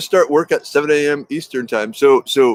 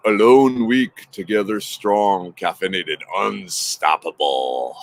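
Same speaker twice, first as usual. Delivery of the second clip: slow, solemn slogan sinking lower to the end; dramatic motto; 3 semitones lower, slower than usual. Pretend. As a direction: declaim the tribe's motto like a battle cry. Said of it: "like we say".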